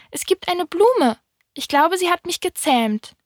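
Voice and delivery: high-pitched little prince, musing. A narrator's reading. Little prince tells the fox about his flower, high-voiced. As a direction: muse aloud in the high prince voice.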